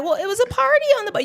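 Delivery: put-on high, fast little-kid voice; young son voice. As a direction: sound like a young boy explaining. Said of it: "This is how young he was".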